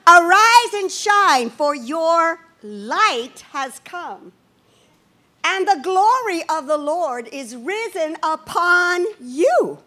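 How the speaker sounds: unhurried scripture read before the congregation repeats it; measured, reverent reading pace; slow scripture reading. Shown as a demonstration. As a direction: read the verse out slowly and solemnly. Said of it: "Say that with me".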